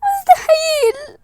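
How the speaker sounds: slow, high, tearful wail; crying. Real, not acted. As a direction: stretch it out, sobbing in disbelief. Crying disbelief.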